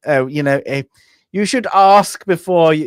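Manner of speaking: lightly hammed-up prim officer's reproach; prim and proper officer. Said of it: "he's very prim and proper".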